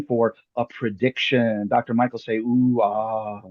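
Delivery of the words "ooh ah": low, drawn-out mystical ooh-ah; mock awe. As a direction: feign wonder for the magic prediction. Magician prompts awe at prediction.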